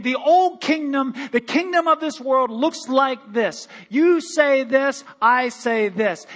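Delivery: loud, slow proclaiming; Jesus proclaiming the new kingdom, loud and slow; mock proclamation. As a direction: declare it from the throne. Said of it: "He's saying".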